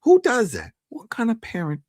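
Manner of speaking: high-pitched, indignant rhetorical question; incredulous disapproval. Real, not acted. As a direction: exclaim in real disbelief at bad parenting. Indignant at the dad's scheme.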